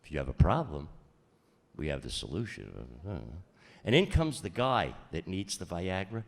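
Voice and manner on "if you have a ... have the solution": low, soft seductive commercial line; sultry ad voice.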